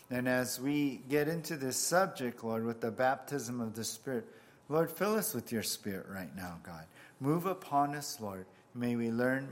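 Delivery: normal talking voice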